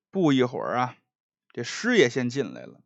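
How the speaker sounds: ordinary talk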